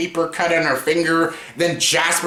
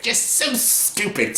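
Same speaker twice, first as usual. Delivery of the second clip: usual speaking voice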